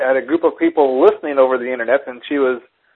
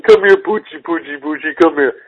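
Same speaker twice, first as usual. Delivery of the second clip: dog-calling voice. Retold or imitated. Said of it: "give us your best doggy voice".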